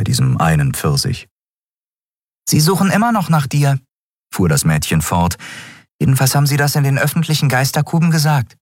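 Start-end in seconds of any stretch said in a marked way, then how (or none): 2.5-3.8 put-on high street-kid girl voice; lively young girl, matter-of-fact. A narrator's reading. 6.0-8.6 put-on high street-kid girl voice; lively young girl, matter-of-fact. A narrator's reading.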